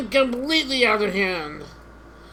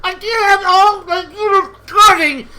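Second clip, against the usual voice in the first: loud, much higher, fast yelling; furious shout at the invaders; angry yell; 7 semitones higher, 6 dB louder than usual. Real, not acted. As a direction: shout them out of your territory.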